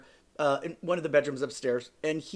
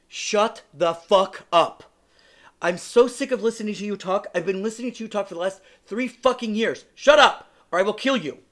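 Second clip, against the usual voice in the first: furious outburst; 5 semitones higher, 7 dB louder than usual. Retold or imitated. Telling off his ex at the restaurant, loud furious shout. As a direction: let it all out at him.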